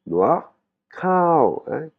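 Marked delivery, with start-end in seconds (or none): 0.9-1.6 "kao" way up high, falling off; rising high tone. Shown as a demonstration. Teacher splits up the phrase, high tone on "kao". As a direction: send "kao" high and stretch it. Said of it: "un son montant aigu".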